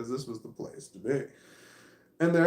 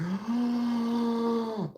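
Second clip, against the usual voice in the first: long, high imitated gasp; stunned gasp; 8 semitones higher than usual. Imitating the woman who was screamed at, stunned gasp. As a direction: draw out a shocked intake of breath. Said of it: "she was so stunned".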